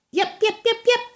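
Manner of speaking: very high yaps; yapping dog. Acted. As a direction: yap like a tiny terrier.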